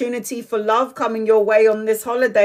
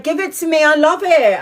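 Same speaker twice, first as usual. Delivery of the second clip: higher, eager, lightly voiced cousin; mock camp demand; 7 semitones higher than usual. Cousin demanding her shorts, lightly camp. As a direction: grab it and gush. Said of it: "it's like".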